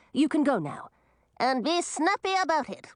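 high, snappy little crab; bossy tiny crab. Acted.

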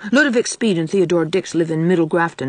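ordinary delivery

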